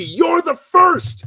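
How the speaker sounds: loud, slow and high on "first"; emphatic praise. Retold or imitated. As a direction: lean hard on "first". Mentor stresses you're first.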